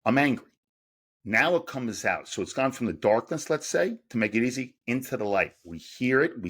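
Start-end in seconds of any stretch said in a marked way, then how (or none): 0.0-0.5 I'm angry said aloud, a bit louder and firmer; spoken out loud. Shown as a demonstration. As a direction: voice the anger out loud, not just think it. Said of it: "different than verbalizing it".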